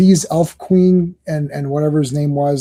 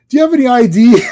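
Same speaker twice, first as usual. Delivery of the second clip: higher, louder acted question; mock questioning; 7 semitones higher, 5 dB louder, faster than usual. Retelling his question as she offers herself in the car, mock questioning. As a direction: put on a man asking for proof.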